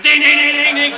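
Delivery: very high, loud clanging; gleeful imitation of a cup on cell bars; rattling cup noise. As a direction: ring out dings like tin on bars. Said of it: "rattling his cup on the bars".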